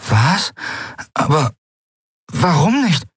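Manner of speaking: can't believe she is leaving; shocked disbelief. Acted. Brother Dao stunned that Lien won't stay, high disbelief.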